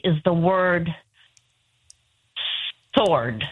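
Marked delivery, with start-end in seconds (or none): 3.0-3.4 sword with silent w; right pronunciation. Shown as a demonstration. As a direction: say sword as SORD. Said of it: "see if I can get it out right".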